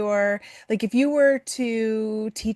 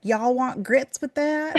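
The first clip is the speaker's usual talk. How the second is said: slow, high-pitched Southern drawl; hammed-up Southern waitress, drawn out and higher; horrible Southern accent; 6 semitones higher, slower than usual. Diner waitress asking a travelling family, bad Southern accent. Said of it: "that was my horrible Southern accent".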